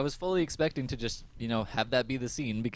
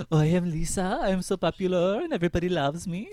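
higher, louder mock French accent; a hammed-up French accent, posing as Lisa; 6 semitones higher, 5 dB louder than usual. [name] pretending to be her twin, in a mock French accent. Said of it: "does a French accent".

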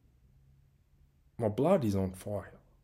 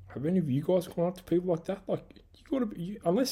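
incredulous high-pitched disbelief; 9 semitones higher, faster than usual. Real, not acted. Ranting to listeners about the coach, incredulous high voice. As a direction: voice jumps high in outraged disbelief.

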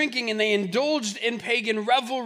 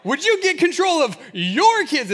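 higher, louder, slowed accusing wife voice; annoyed wife; 4 semitones higher, 6 dB louder than usual. Retold or imitated. Wife Angel blaming John for the kids, mock accusing. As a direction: blame him for the kids, laughing. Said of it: "It's always".

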